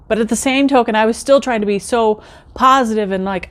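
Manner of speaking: ordinary delivery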